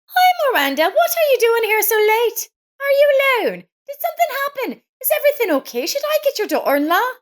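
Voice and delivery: put-on high, bright-eyed concerned young woman's voice; kind neighbor Lisa. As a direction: play the caring neighbor, worried and eager to help.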